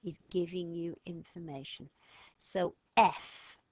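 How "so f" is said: F said loud and set apart; stressed letter F. Shown as a demonstration. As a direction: hit the letter F hard. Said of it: "what do you think it stands for".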